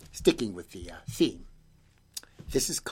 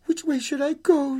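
put-on high, small child voice; lost little girl; 4 dB louder than usual. Girl wonders alone on the hill, high child voice.

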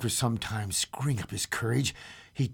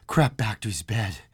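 usual speaking voice

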